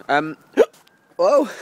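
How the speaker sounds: startled hiccup then whoa. Real, not acted. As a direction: hiccup catches her by surprise. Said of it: "hiccups are here".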